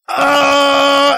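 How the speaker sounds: loud, high exasperated shout; frustrated cry. Acted. Mage robbed of his big spell cries out in loud frustration. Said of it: "he's just like".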